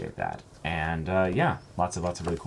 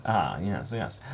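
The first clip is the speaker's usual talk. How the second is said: ordinary delivery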